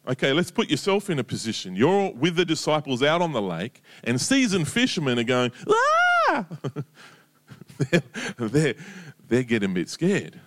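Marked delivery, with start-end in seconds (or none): 5.7-6.5 high startled fisherman's yelp; panicked cry. Acted. Fishermen in the storm, a sudden high panicked cry. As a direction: shriek like a scared sailor.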